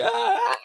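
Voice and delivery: high-pitched pretend crowd "aah"; mock studio-audience cheer. Playing the audience as a guest comes in, mock cheering. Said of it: "studio clap whenever Doom says something".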